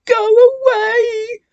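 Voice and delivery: high, loud panicked cry; frightened shout. A narrator's reading. Twins fleeing the ghost, crying out. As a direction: yell it in terror. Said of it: "the twins cried".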